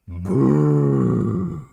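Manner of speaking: loud high mock boo from the crowd; a jeering audience boo. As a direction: boo him off the stage. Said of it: "here's what I heard".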